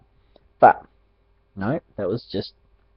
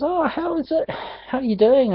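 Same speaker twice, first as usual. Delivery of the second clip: a lightly voiced cheery dad, pitched higher; imitating dad; 13 semitones higher, 4 dB quieter than usual. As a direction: mimic dad's chirpy hello from the van. Retelling dad's cheery roadside greeting.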